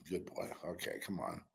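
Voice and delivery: soft, low praise for a dog; soothing a pet. Real, not acted. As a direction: murmur gently to the dog. Calming the dog.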